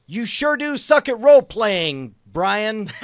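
loud, high, hammed-up jeer; mocking taunt. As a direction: jeer at Brian with a big grin. Hammed-up mocking quote dictated.

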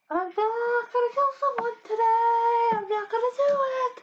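very high, slow, strained mock shout of denial; manic denial. Student to herself in class, shrill-high manic denial. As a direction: squeal it out like a kid insisting on innocence. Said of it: "I'm just like".

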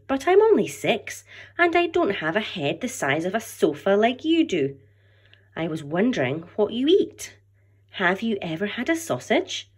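lively storybook read-aloud to a class; story narration. A narrator's reading. Teacher reading a picture book aloud, animated storytelling. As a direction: read it brightly, voicing each character for the children.

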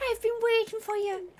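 soft Irish wizard voice; Irish accent. Acted. Wizard welcoming the player, soft Irish accent. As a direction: welcome her in an Irish lilt.

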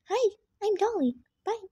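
high cute toy-doll voice with giggles; squeaky giggling doll introduction. Pretend. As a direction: play the doll greeting everyone.

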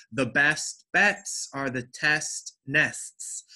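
slow tongue twister with crisp T in "best bets"; hard T. Shown as a demonstration. Teacher shows students how to practice this sound, hard T. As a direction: hit each T in "test nests", word by word. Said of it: "a way to practice this sound".

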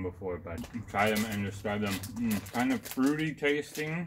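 speaking between bites of candy; chewing candy while talking. Real, not acted. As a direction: mouth partly full, chewing. Seen 0: Man puts candy in mouth at -2, talks chewing, holding more candy.

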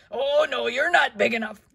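grouchy loud retort. Acted. Grouchy ladybug dismisses skunk.

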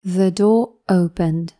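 ordinary delivery

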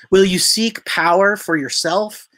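ordinary delivery

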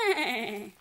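whinny it like a horse; a light horse neigh. Acted. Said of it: "said Horse".

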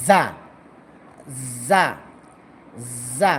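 each জা a strong z buzz into a short vowel; firm za. Shown as a demonstration. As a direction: press the z in জা hard. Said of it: "একটু শক্ত হবে". (জা একটু শক্ত করে)

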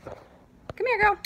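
high sing-song call to a dog; talking to a dog. Real, not acted. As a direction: call the dog over sweetly. Calling the dog over.

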